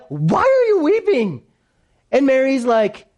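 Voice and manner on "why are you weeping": very high, exaggerated disbelief; incredulous angels. Angels asking Mary at the tomb, incredulous. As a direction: ask it as if baffled, voice soaring.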